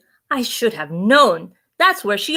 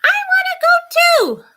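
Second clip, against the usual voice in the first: much higher, loud whining scream; shrill child scream; 3 dB louder, faster than usual. A narrator's reading. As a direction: scream it like a furious little sister. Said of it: "Sana screams".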